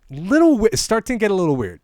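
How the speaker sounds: mock uneasy acquaintance, pitched well up. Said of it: "real things that people have said to me".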